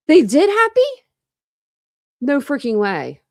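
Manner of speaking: high, loud disbelieving cry; shocked and thrilled; surprised. Real, not acted. Reacts to surprising news.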